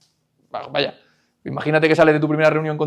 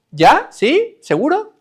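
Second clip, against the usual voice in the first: much higher, louder nagging ¿Ya? ¿Sí? ¿Seguro?; pestering questions; 12 semitones higher, 6 dB louder, much slower than usual. Retold or imitated. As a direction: needle the child with each question, pitch way up. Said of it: "es como".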